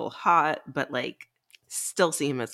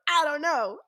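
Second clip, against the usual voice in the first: squeaky, helpless high voice; high-pitched innocent I-just-work-here voice; high mock shrug voice; 12 semitones higher than usual. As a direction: play dumb in a high little voice. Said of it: "I'm like".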